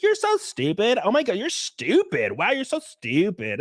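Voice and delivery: high, scoffing Valley-accent imitation; mock Valley-accent disdain. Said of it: "he has, like, a weird Valley accent".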